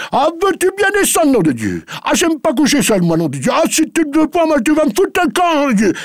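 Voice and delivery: high, loud blustering rant; angry old master. Acted. Farmer orders his maid to eat with him, furious bluster. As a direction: splutter and bully her to sit and eat.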